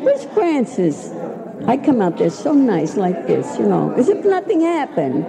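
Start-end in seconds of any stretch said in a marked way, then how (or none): 0.0-1.1 high, slow mock call; girls calling out. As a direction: drag the question out, searching. Girls searching, calling for her.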